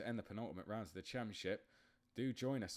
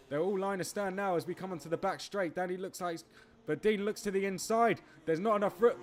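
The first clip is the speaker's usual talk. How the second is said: fast, high, loud play-by-play; excited race commentary; 6 semitones higher, 8 dB louder than usual. Acted. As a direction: call the race as it happens. Live race commentary building up.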